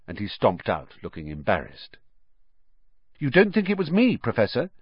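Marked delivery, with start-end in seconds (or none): 3.2-4.7 high, tentative schoolboy question; pitched-up, hopeful appeal. A narrator's reading. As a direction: ask it wanting reassurance. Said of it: "Harry repeated hopefully".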